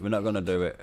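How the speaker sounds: ordinary delivery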